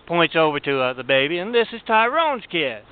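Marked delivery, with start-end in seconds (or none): 1.4-2.8 higher, whining plea in a put-on voice; pleading mother. Mother pleading with guards over her kids, higher whine. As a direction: play the sob story back up, pitched high.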